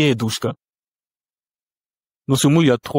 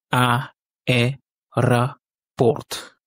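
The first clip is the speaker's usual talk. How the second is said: "A, E, ra, porte" in four separate beats; syllable by syllable; much slower than usual. Shown as a demonstration. Teacher counts out the four syllables, syllable by syllable. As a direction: pause between A, E, ra and porte. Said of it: "le mot lui-même contient quatre syllabes".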